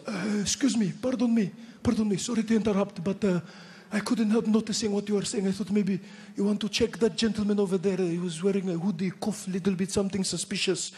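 put-on sly, apologetic tattletale in a Pakistani accent. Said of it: "he goes".

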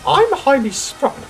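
high-pitched, fussy prima donna voice; haughty lady diesel. Acted. Daisy turns down the engine shed, fussy and haughty. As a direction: complain grandly in a high voice.

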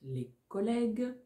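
dictating. Shown as a demonstration. Dictation chunks.

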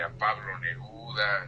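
usual speaking voice

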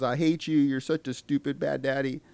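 half-hearted imitation of a kid's outburst; retold yelling. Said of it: "The kid freaks out and yells".

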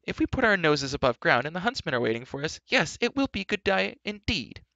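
high, loud, scolding rant; exasperated, complaining tirade; indignant outcry. A narrator's reading. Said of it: "cried Elmy".

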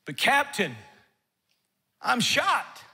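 a lightly voiced hurt soldier protesting; wounded lieutenant. Lieutenant telling his captain he is hit, lightly acted pained plea. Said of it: "the response of the lieutenant is".

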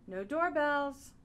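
usual speaking voice

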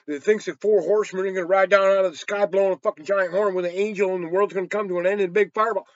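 ordinary delivery

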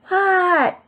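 high, stretched-out reply; calling back. A narrator's reading. Froggy answers his mother's call, high shout back.

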